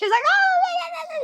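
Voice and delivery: very high drawn-out squeal; hammed-up hysterical wail; mock screaming. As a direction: squeal it out slowly with laughter. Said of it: "just, like, screaming and yelling".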